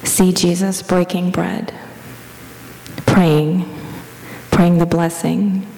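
even, level prayer-meditation voice with long pauses; guided meditation. Real, not acted. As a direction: guide them gently into the imagined scene, leaving space between phrases.

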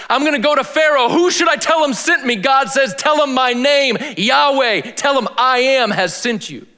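loud, high, ringing retelling of the burning bush; preaching at full force. Acted. Preacher retelling the burning bush to his congregation, loud and high. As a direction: proclaim it to the back row.

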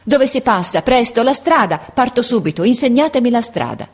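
high, urgent, pleading demands; violent resolve. Acted. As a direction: burst out, refusing to wait a moment. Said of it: "con un impeto di risoluzione violenta". (richieste impetuose e risolute)

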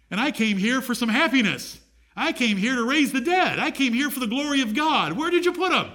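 high-pitched, insistent build to a demand; impatient declaration. Acted. Preacher voices Jesus who came to raise the dead, impatiently insistent.

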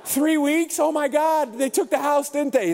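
high-pitched comic disbelief; hammed-up dawning alarm; mock shock. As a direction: play a neighbor aghast at the news. Acted neighbor realizing the house was taken.

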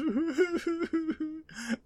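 mock sob. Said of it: "bawling on the sidelines".

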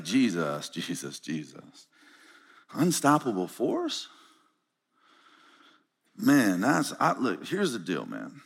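low, soft, nervous disciple; a buddy pulling Jesus aside quietly; hushed worried disciple. Acted. Disciple quietly pleading with Jesus, low and hushed.

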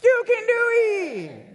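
high, loud, slow Cajun-accent yell; Water Boy accent. Shown as a demonstration. Preacher leading the crowd in a high loud Cajun-accent yell. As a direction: belt it out in a Cajun accent, drawn out. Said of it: "You gotta do it with the accent".

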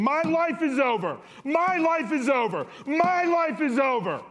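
high, loud, desperate shouting; anguished scream, over and over; retold scream. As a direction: yell it like your life is ending. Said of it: "And I'm screaming".

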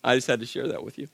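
Jesus done lightly as the Church Lady. Retold or imitated. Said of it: "that's all I can think of".

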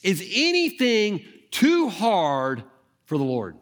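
preacher repeating the key question to the congregation; slow repeated question. Shown as a demonstration. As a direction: repeat it slowly so it sinks in. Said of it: "I'm gonna keep this up here".

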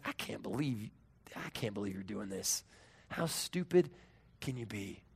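low, quiet disbelief; a touch of mock scolding, held down; mild exasperation. God imagined scolding Elijah, quietly exasperated.

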